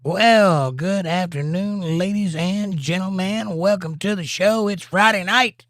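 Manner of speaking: slow, high announcer welcome; show host welcome. Acted. Host greeting the live audience, big announcer voice. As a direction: open the show like a showman.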